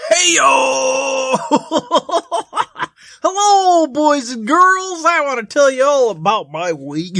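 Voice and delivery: loud, high, goofy clown voice; hammy children's entertainer voice. Acted. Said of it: "Never clown voice".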